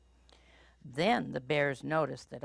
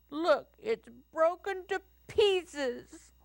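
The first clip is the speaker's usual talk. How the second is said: high, slow little bear wail; baby bear voice; 4 dB louder, slower than usual. A narrator's reading. Little Bear shows his broken chair, high baby wail. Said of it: "in his baby voice".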